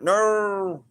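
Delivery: sharp high cry of pain. Real, not acted. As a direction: yelp as the back gives. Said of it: "my back went".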